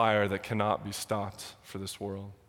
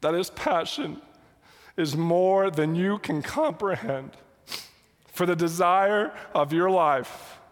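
high and strained, fighting back tears; close to tears; 7 semitones higher, 3 dB louder than usual. Real, not acted. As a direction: push the words out while holding back a sob. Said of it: "I can't, I'm sorry".

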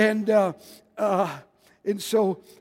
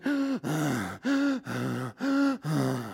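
low, faint gasp of a dying man; 5 semitones lower, 6 dB quieter than usual. Retold or imitated. Preacher imitating dying Tommy on his deathbed, faint gasp. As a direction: imitate a man struggling for breath. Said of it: "and he was just".

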